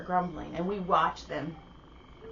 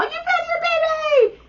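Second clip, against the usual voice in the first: high, loud cheer for the dad; 8 dB louder than usual. Retold or imitated. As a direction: cheer him like he won a prize.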